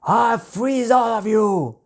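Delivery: a huge, slow, high roar from the ice dragon king; booming dragon king. A narrator's reading. The dragon king threatens the bear team, booming. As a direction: boom it out like a furious giant dragon. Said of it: "boomed King Kosetsu".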